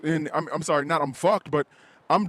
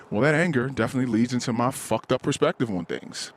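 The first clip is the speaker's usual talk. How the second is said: normal talking voice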